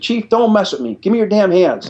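retold chief barking orders; stern order. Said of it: "She says".

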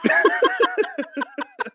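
high-pitched cackling laugh; real laughter. Real, not acted. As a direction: crack up helplessly. Cracks up after own reply.

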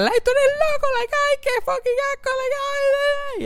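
very high-pitched disgusted squeal; retold screaming. Kid spat on in the face, retold screaming. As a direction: shriek it in mock horror. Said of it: "Y yo empecé a gritar".

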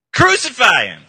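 very loud crowd cry; angry mob shout. Acted. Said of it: "They shouted back".

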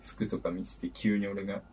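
usual speaking voice

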